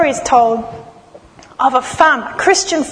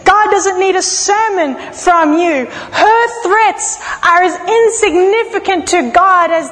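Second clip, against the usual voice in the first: higher and pleading, slowed on the rebuke; impassioned preaching; 4 semitones higher than usual. Acted. Preacher rebuking Elijah before the congregation, impassioned. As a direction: plead with Elijah to stop complaining.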